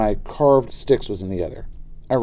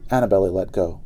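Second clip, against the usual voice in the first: plain reading voice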